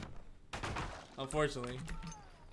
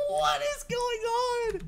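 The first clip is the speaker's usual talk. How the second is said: much higher, slow laughing shout; disbelieving shout; slower than usual. Real, not acted. Streamer baffled by a survivor, high laughing shout. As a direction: yell it in amused disbelief.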